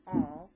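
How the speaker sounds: Although said loud and emphatic, rising at the end. Shown as a demonstration. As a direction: belt out Although. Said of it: "many people spell it with two A's".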